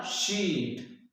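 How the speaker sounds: "शीट" said very slowly, the ee held long; "शीट" with a long held ee, as a teaching demo; drawn-out long vowel. Shown as a demonstration. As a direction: stretch the ee of "शीट" to show a long sound. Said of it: "इनका pronunciation करते वक्त हमें ज्यादा समय लगता है".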